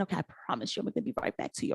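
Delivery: softly breathed, whispered reassurance; quietly fob off the patient; hushed promise. Retold or imitated. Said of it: "people would be like".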